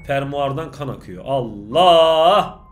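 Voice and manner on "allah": loud rising shocked outburst; startled cry. Real, not acted. Shocked exclamation. (korkuyla Allah diye haykırış)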